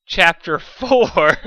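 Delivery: slow booming title call; grand announcement. Acted. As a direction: announce it like a showman. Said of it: "Thank you".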